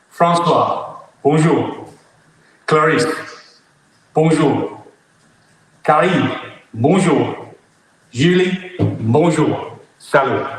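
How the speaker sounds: each name and greeting set apart by pauses; slowed down. Real, not acted. Greeting friends in France after talking too fast, slowed right down. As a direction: say it slowly, one word at a time. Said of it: "talking too fast, so I'll say that again".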